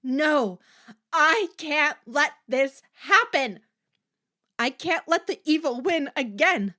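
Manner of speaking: high, loud, slowed defiant cry; desperate scream. Acted. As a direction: shout it with rising rage. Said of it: "a voice screamed in her head".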